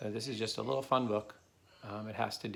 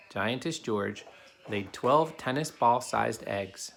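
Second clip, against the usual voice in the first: put-on steady storybook narration for kids; reading a story aloud; 5 dB louder than usual. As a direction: read it to the class like a picture book. Reads a picture book to children.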